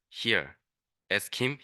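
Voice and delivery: ordinary delivery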